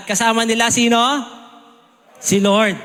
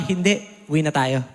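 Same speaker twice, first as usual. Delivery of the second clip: low, quiet leader's answer; calm Joshua; 7 semitones lower, 3 dB quieter than usual. Retold or imitated. Joshua calmly telling soldiers to go home, low and soft. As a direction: drop low and soft, unbothered.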